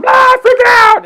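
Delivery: very high, loud mock scream; hammed-up freak-out; panicked kids yelling. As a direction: yell like a kid losing it.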